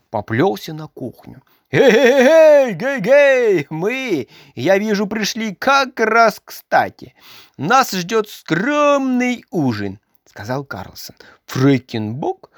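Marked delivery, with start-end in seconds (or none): 1.7-10.0 loud, higher, slow jolly greeting; hammed-up cheerful Karlsson; cheery entrance. A narrator's reading. 10.3-11.2 soft breathy whisper; whispered narrator aside; whispered tag. A narrator's reading.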